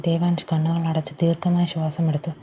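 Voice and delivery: ordinary delivery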